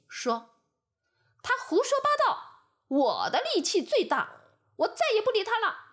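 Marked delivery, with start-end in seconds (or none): 1.4-5.8 higher, louder and fast, huffing with indignation. A narrator's reading. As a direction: blurt it out in an offended rage. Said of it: "气得直喘粗气".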